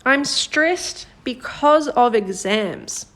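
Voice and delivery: slow, careful read of the example sentence. Shown as a demonstration. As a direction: read it slowly, word by word. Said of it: "This first one is".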